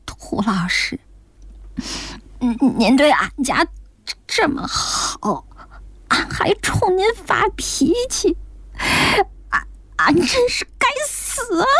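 带着哭腔，越说音越高; 抽噎着断断续续地道歉，气声发颤; 哽咽自责. Acted. 愧疚道歉的老太太哭诉. (愧疚得哭出来)

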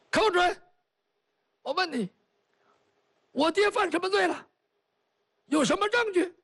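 high, demanding interrogation; stern empress. Acted. Empress rounding on Kou Zhun, high and demanding. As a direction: press him hard for answers.

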